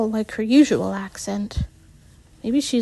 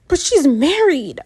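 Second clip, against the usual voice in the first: higher-pitched huff; huffy protest; 5 semitones higher than usual. A narrator's reading. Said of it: "Shapri huffs".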